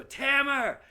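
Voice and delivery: high, loud call across the boardwalk. Retold or imitated. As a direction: call a name from afar, drunk. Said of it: "I hear this voice go".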